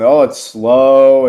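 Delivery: loud, drawn-out mock grumble; mock complaint. Mimicking users' complaints about problems.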